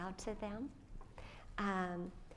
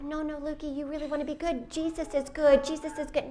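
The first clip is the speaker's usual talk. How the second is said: higher, louder mock plea that Jesus is good; anxious pleading; 6 semitones higher, 9 dB louder than usual. Mother imitates her own anxious pleading with her son. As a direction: fret over the child, pressing him. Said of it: "And I'm like".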